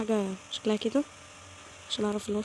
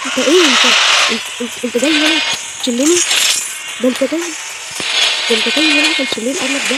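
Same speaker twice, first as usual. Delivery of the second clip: loud, outraged shouting at the rabbit; real furious rant; angry yelling; 3 semitones higher, 9 dB louder than usual. Real, not acted. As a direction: tell the rabbit off at full volume.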